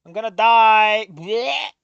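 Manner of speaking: slow, loud hammed-up death line; mock dying cry. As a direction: ham up the dying words slowly. Dramatic dying line read out.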